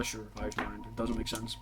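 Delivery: normal talking voice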